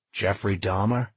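ordinary delivery

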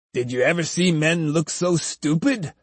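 plain reading voice

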